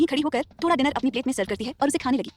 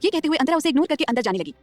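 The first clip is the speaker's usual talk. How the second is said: ordinary delivery, nothing marked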